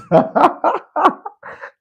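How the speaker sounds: high, helpless giggling; real laughter. Real, not acted. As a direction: burst out giggling at a high pitch. Cracking up at Edge's voice.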